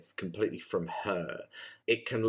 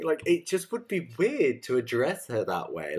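ordinary talk, nothing marked

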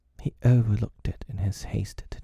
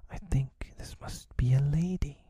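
put-on higher, lightly frightened boy; scared voice; 7 semitones higher than usual. A narrator's reading. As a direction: raise the pitch with a touch of fear. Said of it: "he said in a scared voice".

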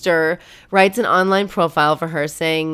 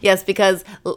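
normal talking voice

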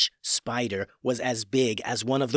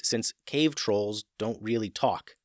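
plain reading voice

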